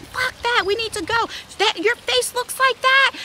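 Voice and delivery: high-pitched, heated retort; angry refusal. Retold or imitated. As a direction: re-enact a mother brushing off all objections. Furious mother overrules calming daughter.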